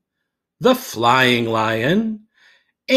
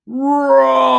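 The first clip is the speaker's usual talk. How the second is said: the word roar roared much higher and louder; ferocious roar; 11 semitones higher, 5 dB louder than usual. A narrator's reading. Boy finally roars for the lion teacher, loud high roar. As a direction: belt out roar like a big cat. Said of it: "I let out a ferocious".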